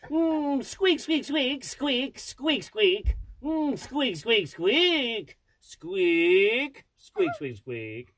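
mouse squeaks. Pretend. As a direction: keep squeaking like a little mouse. Dad keeps doing mouse squeaks.

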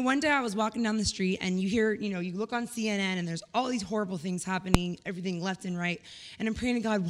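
ordinary delivery

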